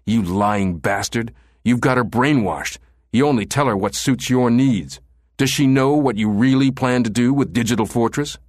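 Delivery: angry tirade with climbing pitch; fired back. A narrator's reading. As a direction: build from insult to indignant challenge. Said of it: "Hale fired back".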